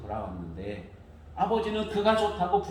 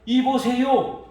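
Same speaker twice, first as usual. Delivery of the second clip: 이보세요 high and loud, falling; rude hey you; 9 semitones higher, 9 dB louder, much slower than usual. Retold or imitated. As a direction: snap 이보세요 at the father. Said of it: "그러는 거예요".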